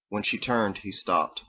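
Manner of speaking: ordinary delivery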